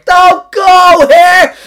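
very high, loud, drawn-out comic cry; mock yell. Mocking a balding man clinging to hair, loud high drawn-out yell. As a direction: wail it slowly at full volume.

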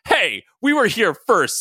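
loud, slow, high claim shouted across the street. Acted. Silver Samurai shouting at the newcomers, slow loud shout. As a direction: bellow it to stake your claim. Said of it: "Silver Samurai shouted".

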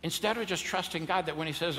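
usual speaking voice

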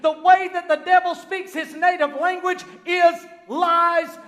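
loud, high, drawn-out sermon cry; full-force preaching. Real, not acted. Preacher exposing the devil's lies to his congregation, full-force preaching. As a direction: hammer each phrase home at full voice.

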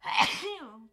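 sudden loud sneeze; one sharp sneeze; sneezing. Real, not acted. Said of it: "Um, I'm gonna sneeze".

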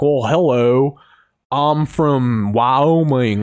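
slow, stretched mock-Wyoming accent; bad American impression. Mocking English comedians' impression of Americans, slow American drawl. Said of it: "English comedian do an impression of an American".

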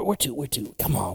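normal talking voice

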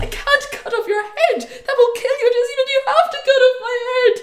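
hammed-up high protest through laughter; laughing through it. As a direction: play the prince's horror while cracking up. Prince refusing, teller laughing.

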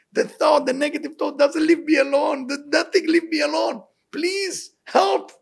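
high, pleading cry to heaven; desperate plea. Acted. Acted desperate prayer to God.

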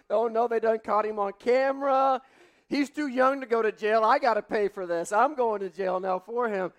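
high, loud hammed-up panic; comic dread of going to jail; mock panic. Panicked dad fearing jail.

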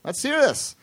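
high-pitched celebrity impression; imitated high, casual star voice; Tom Hanks. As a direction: put on the star's high voice. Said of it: "he says".

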